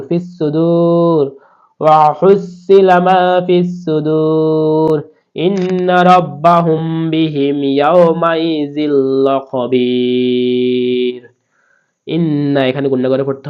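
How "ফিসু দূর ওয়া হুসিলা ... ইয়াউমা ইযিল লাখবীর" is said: slow, raised Quranic chant; slow recitation. Shown as a demonstration. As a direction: lift the verse and draw every vowel out. Reciting the verse.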